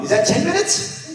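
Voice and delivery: high, teasing call from the daughter; mock daughter checking the clock; daughter's reminder. Daughter voiced, calling time.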